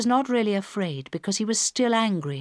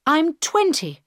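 angry; 9 semitones higher, 4 dB louder than usual. A narrator's reading. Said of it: "she said angrily".

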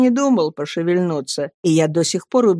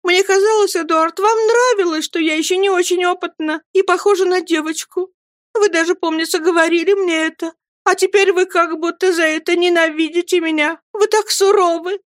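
high, tearful pleading; young wife begging, close to crying, pitched high throughout; holding back tears; 11 semitones higher than usual. A narrator's reading. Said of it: "глотая слёзы, проговорила она". (высоким голосом, умоляя сквозь слёзы)

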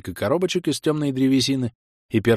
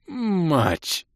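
pained curse; 7 semitones higher than usual. Acted. Curse after crash.

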